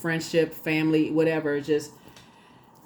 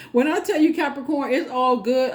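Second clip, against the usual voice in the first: excited; 7 semitones higher, 4 dB louder than usual. Real, not acted. Excited hype at Capricorn.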